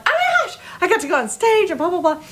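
high, fast, gushing; excited gush to mom. Retold or imitated. Daughter calling mom from payphone, excited gushing. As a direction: spill it all out breathlessly. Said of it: "talking to my mom like".

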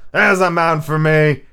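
loud, slow pirate approval; admiring cry. A narrator's reading. Silver applauds Dick's question, admiring cry. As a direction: relish it as an admiring buccaneer. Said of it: "cried the cook admiringly".

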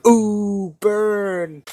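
drawn-out teasing "ooh"; hype crew jeer. Acted. Party backing up Leo's mockery, teasing jeer. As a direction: react like a backing hype team. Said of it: "like, us just going".

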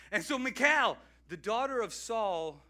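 usual speaking voice

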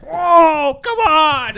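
loud, high yell at the TV; retold outburst. Host reliving yelling at a pick six in a restaurant, loud high shout. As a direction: shout in disbelief at the play. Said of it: "I caused a scene".

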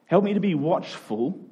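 ordinary delivery

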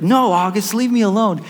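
high mock yell at brother; upset daughter. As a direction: imitate a little girl refusing crossly. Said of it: "mad or upset with your brother".

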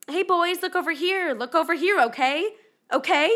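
put-on high, loud call to the kids; calling paparazzi. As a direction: yell for their attention over the crowd. Paparazzi yelling at the boys.

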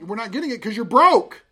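loud playful scold; teasing dad. Retold or imitated. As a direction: rib the kid about being broke. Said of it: "We're getting it 'cause you".

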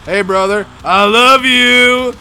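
slow, loud sing-song mockery; mock drunk bro. Streamer mocks the moshing bro's sloppy pep talk, slow and loud.

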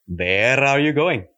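slow Indian accent, R rolled and stretched; slow Indian-accent demo, R drawn out. Shown as a demonstration. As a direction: stretch the R in "going" and "Where", Indian style. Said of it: "a enunciation of the R and stretching the R out".

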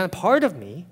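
ordinary delivery

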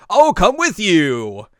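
loud, high, drawn-out offer; eager volunteering. Acted. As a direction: pipe up with hammed-up eagerness. Setsuna eagerly volunteers, acted.

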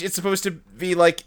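normal talking voice

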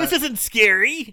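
high, loud, slow put-on disdain; mock scoffing. Co-host plays Damien unimpressed by the doll, mock scoffing. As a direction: draw it out in a mocking high voice. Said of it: "scoffing at the doll".

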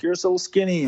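high, teasing parent voice; mocking parent. Parents teasing their skinny son, in a high mocking voice. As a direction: needle him like a nagging parent.

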